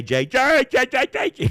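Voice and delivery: high, loud sing-song calling of Jay; shrill call. Retold or imitated. Imitating her calling the dog Jay, high and loud.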